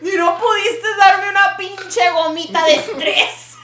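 high, loud slow mock outrage; hammed-up furious shouting at mom; mock angry rant. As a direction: rant at mom over the gummies. Scolding mom, retold.

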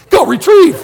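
very loud, high order; shouted command. Retold or imitated. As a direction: send the dog off with a yell. Retold shouted order to dog.

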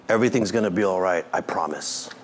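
soft, tender reassurance fading to a hush on promise; loving promise. Pretend. God holds your shoulder and comforts you, tender hushed promise. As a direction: lean in and comfort, a touch of God's tenderness. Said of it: "with love and power and justice".

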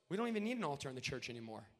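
low, soft and quick retold answer; understated quiet reply. God answering the preacher's complaint, low understated reply.

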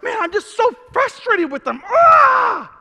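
high, loud exasperated complaint ending in a yell; fed up with your spouse, venting; rising frustrated whine into a shout; frustrated outburst. Retold or imitated.

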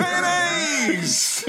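high-pitched drawn-out cry, comic mimicry; imitating Sam. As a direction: squeal the word out in a put-on voice. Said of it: "Sam's just watching going".

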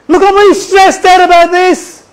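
high, loud, frantic complaint; stressed outburst. Acted. A believer unloads stress on God, loud and high. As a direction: burst out at the end of your tether. Acted stressed prayer before thanksgiving.

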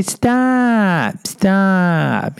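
high, whiny, helpless stop; whiny plea. Retold or imitated. Bullied kid pleading with towel-whipping bullies, high whiny plea. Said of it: "and I say".

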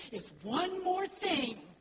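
like someone at the very end of their rope; desperate outcry. Acted. A stressed person pleading with a friend, desperate loud cry. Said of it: "when they come to you and say".

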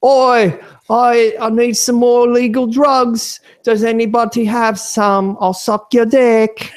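high, slow chavvy Mary Poppins; [name]. Acted. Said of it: "what do you think [name] has been talking about".

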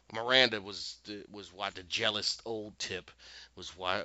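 ordinary talk

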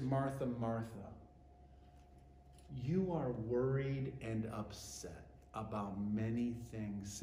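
soft, low and tender; gently naming her worry; loving pity. Acted. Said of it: "It's a sense of love".

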